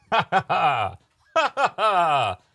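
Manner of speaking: loud, high, drawn-out laughter; hearty stage laugh. Acted. Reconciled magicians laughing off the duel, hearty laugh. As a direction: laugh big and theatrical.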